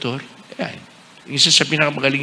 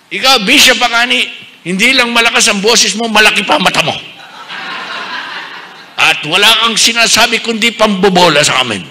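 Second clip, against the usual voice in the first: angry outburst; 5 semitones higher, 4 dB louder than usual. Retold or imitated. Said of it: "nagalit sa akin".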